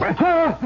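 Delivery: sharp high yelp of fright; startled cry. Acted. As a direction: jolt out a scream. Startled by a face in lantern light.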